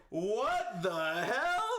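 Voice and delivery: high, drawn-out mock shock; stunned group reaction. Storyteller imitating the friends' reaction, slow high shock. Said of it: "And we were all like".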